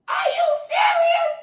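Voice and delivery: high disbelieving yell; outraged shout. Retold or imitated. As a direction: cry it out in disbelief. Outraged repeated question.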